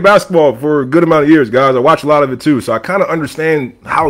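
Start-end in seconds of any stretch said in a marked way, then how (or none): none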